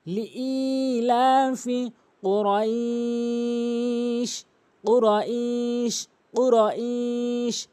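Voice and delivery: slow chant of লিইলাফি কুরাইশ, vowels held long; model recitation. Shown as a demonstration. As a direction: stretch the final "ai" of কুরাইশ. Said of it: "আমার সাথে বারবার practice করবেন".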